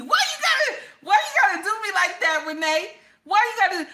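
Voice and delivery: high, loud laughing outcry; mock hurt, laughing at a viewer; laughing protest. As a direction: cry out through laughter at her. Mock outrage at Renee's comment.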